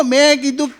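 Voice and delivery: normal talking voice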